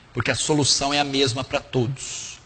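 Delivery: ordinary talk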